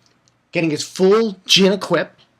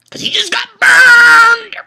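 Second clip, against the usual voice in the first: much higher, louder gloating yell; gleeful shout; 9 dB louder than usual. Real, not acted. Vlogger mocking Alibaba's burn, shouting gleefully. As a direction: crow it out loud as a taunt.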